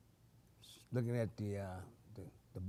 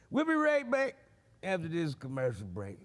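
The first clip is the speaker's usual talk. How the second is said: TV announcer; 3 semitones higher than usual. Acted. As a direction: toss to the break like a host. Fake TV host sign-off.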